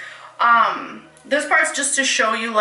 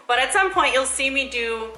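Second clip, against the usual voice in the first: ordinary talk, nothing marked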